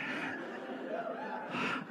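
a high, airy burst of laughter; high breathy laugh. Real, not acted. Laughs at own joke.

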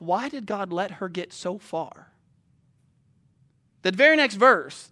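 ordinary talk

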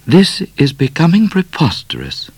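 high, indignant lady's voice; a proper young lady put out; high scandalised lady; Miss Laura, prim lady. A narrator's reading. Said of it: "said Miss Laura".

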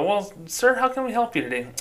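high-pitched, polite customer-service voice; bank greeter. Acted. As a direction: put on a high bank-staff voice.

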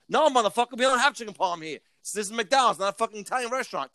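lightly acted annoyed worker; mock irritated clerk. Imagined McDonald's clerk snapping.

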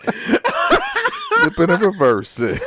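high, drawn-out words through laughter; laughing. Real, not acted. Cohost repeats a [name] line to friends, laughing. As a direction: laugh helplessly while stretching out the quote.

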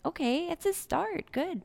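high, soft retold reassurance; gentle encouragement. Telling herself the customer has made a start, gentle encouragement. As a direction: lightly act out polite approval, high and soft.

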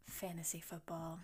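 hushed confession; whispering. Real, not acted. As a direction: whisper it like a secret. Confessing guilty pleasure.

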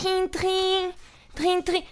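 high sing-song "trim, trim" like a ringing bell; doorbell imitation. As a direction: mimic the doorbell chiming high. Doorbell ringing in acted scene.

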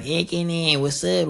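lightly put-on Mexican accent. Shown as a demonstration.

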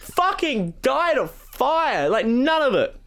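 high-pitched slow outraged shout; outraged refusal. Retold or imitated. Angrily refusing the other factions.

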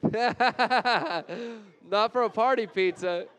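laughing through the words. Real, not acted. Preacher breaking into laughter at the pizza joke. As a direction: crack up mid-line.

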